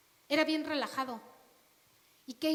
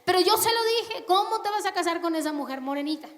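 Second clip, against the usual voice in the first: louder, pointed blame; loud accusing gossip; 5 semitones higher, 6 dB louder than usual. Acted. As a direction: blame the foreign wife loudly. Gossip self-justifying.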